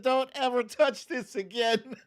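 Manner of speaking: high, scolding mom voice. Retold or imitated. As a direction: play the fed-up mom.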